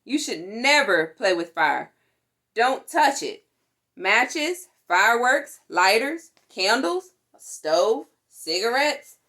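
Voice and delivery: ordinary delivery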